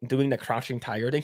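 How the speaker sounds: usual speaking voice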